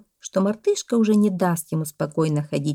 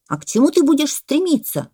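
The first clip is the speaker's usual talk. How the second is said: loud, high question; challenging parrot; 9 semitones higher, 7 dB louder than usual. A narrator's reading. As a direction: demand an answer loudly. (требовательный попугай)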